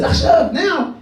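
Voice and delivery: high-pitched, urgent confirmation; retold order to go right away; insistent cue.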